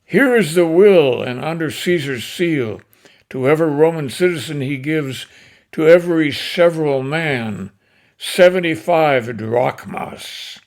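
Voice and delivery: declaiming Shakespeare, swelling to loud peaks; dramatic recitation. Acted. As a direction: deliver Antony's speech to the crowd with rising passion. Antony reads the will.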